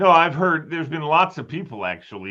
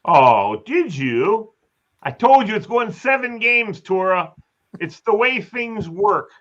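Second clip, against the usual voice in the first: excited; 8 semitones higher, 5 dB louder, slower than usual. Real, not acted. Host teasing friend about prediction.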